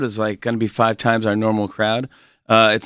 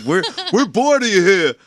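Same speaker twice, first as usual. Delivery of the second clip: higher-pitched mock New Yorker; heckling New York accent; 7 semitones higher than usual. Host voices jaded locals, mock New York accent. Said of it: "why I do that accent".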